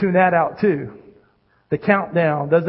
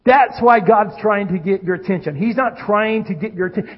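ordinary talk, nothing marked

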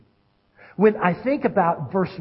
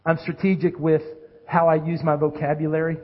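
ordinary talk, nothing marked

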